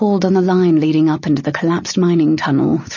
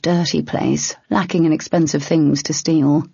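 plain reading voice

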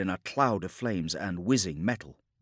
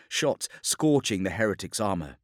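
usual speaking voice

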